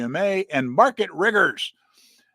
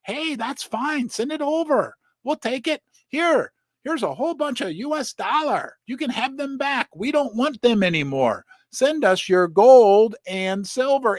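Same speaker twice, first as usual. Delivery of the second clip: casual mock quote; 8 semitones higher than usual. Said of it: "The people in China are like".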